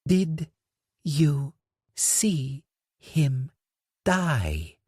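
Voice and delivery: slow pointed word-by-word threat; every word punched. Acted. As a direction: pause and hit every single word. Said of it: "emphasize every single word as pointedly as possible".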